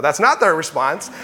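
ordinary delivery

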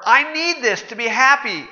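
high pleading mock voice; mock whiny excuse. Pastor parodying a selfish excuse, high and whiny. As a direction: plead it like a spoiled kid.

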